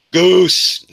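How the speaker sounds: loud, high shout-out; calling out. Real, not acted. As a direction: holler the name like a greeting. Calls out a joining viewer's name.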